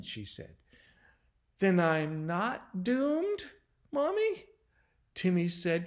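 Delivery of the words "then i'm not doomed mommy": put-on slow, hopeful child's question, lifting high; tentative relief. A narrator's reading. Timmy asking Mommy if he is safe, tentatively relieved. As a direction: ask slowly, hardly daring to feel relieved. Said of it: "trying to feel relieved".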